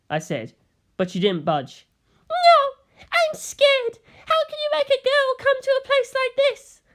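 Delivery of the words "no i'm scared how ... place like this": put-on very high, teary girl's voice; a frightened girl refusing to come, crying; scared little girl. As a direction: whimper it like a frightened child.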